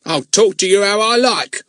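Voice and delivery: high, loud, scolding crone voice; bad-tempered old woman. A narrator's reading. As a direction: snap at the rude girl. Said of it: "in a very bad temper".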